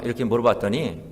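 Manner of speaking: ordinary talk, nothing marked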